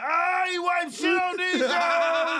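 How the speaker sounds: a crowd yelling in glee at the prank; mock crowd shout. Retelling the crowd's glee, a high hammed-up shout. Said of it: "Everybody like".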